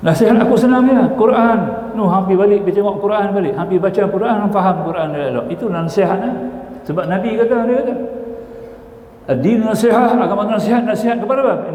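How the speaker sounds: high, emphatic storytelling quote; animated retold admonishing. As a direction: voice pitched well up, imitating brisk advice. Acting out Bahlul advising the caliph.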